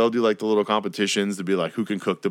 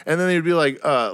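ordinary delivery

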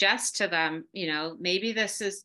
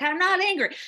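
high, loud, indignant denial; angry denial; 11 semitones higher, 6 dB louder than usual. Retold or imitated. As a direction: snap back that you're not angry at all. Husband denies anger, retold.

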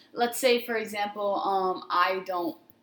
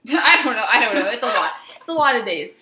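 laughing flustered; 11 dB louder than usual. Real, not acted. Laughing, flustered about confession.

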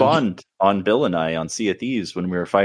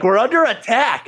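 higher urgent call that they're under attack; alarmed call; 12 semitones higher, 3 dB louder than usual. Retold or imitated. Teammate warning the squad mid-fight, urgent higher call. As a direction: sound the alarm to the team. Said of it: "And you're like".

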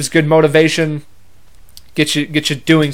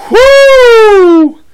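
a high, loud whoo shouted out; wild high whoop; 10 dB louder than usual. Retold or imitated. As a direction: whoop to mark the blow-up. Recalling uncle's reaction.

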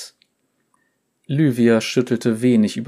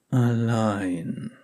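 gentle; 3 dB quieter than usual. Acted. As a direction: finish her sentence gently. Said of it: "schloss er sanft".

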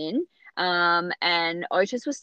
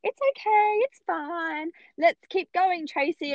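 much higher, sing-song reassurance; reassuring midwife; 9 semitones higher, slower than usual. Retold or imitated. Midwife back in the room, soothing Tracy, high sing-song. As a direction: soothe her into pushing on.

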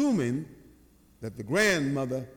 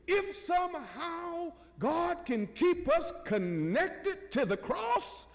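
high, impassioned sermon delivery; preaching; 11 semitones higher than usual. Real, not acted. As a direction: drive the lesson home to the congregation, stretching key words. Preacher drives home the lesson.

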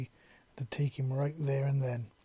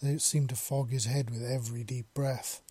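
usual speaking voice

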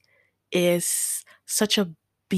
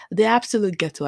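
usual speaking voice

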